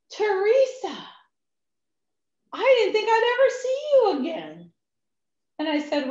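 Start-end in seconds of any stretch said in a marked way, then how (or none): 0.1-4.7 high, louder astonished greeting; amazed delight. Retold or imitated. Mechanic stunned to see Teresa walk into his shop, surprised. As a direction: burst out in disbelief.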